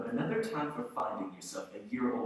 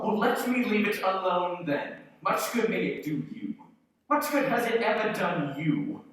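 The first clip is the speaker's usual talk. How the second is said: louder, testy reply; irritated retort; 6 semitones higher, 6 dB louder than usual. Acted. Scrooge snapping at his nephew. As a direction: bite back at him.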